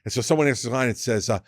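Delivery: ordinary delivery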